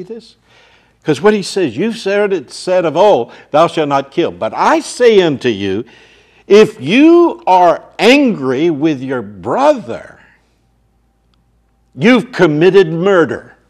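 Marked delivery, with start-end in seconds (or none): 1.8-13.6 loud, ringing pulpit voice; booming sermon delivery; full-force preaching. Acted. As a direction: hammer the warning home to the crowd. Preaching Jesus's words at full force.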